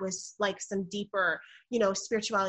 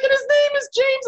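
crying; 12 dB louder than usual. Retold or imitated. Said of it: "I'm, like, crying and crying".

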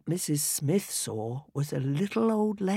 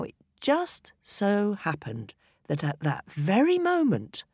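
plain reading voice

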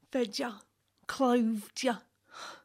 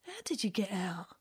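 usual speaking voice

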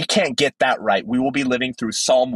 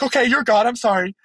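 flustered apology; 8 semitones higher than usual. Pretend. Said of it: "He's like".

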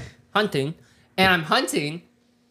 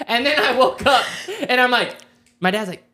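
ordinary talk, nothing marked

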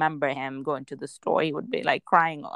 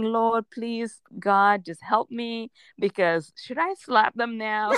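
mock prayer; 5 semitones higher, slower than usual. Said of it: "And I'm thinking".

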